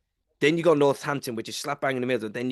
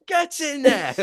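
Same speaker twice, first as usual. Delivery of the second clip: higher, louder excited rally cry; gleeful cheer; 14 semitones higher, 5 dB louder, slower than usual. Retold or imitated. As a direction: egg it on like a happy fan. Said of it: "It's like".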